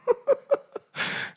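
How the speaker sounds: light amused laugh; short laugh. Real, not acted. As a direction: laugh briefly at the crowd. Preacher laughs at confusion. (헷갈리는 청중에게 가볍게 웃음)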